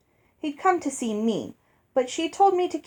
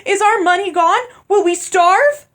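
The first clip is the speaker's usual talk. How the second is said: panicked; 10 semitones higher, 10 dB louder than usual. Acted. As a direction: let fear push the voice up. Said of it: "her voice rising in panic".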